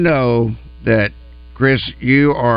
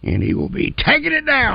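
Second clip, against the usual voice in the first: hyped announcer; 11 semitones higher than usual. Acted. Hyping a guest, announcer call.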